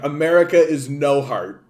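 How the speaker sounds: ordinary talk, nothing marked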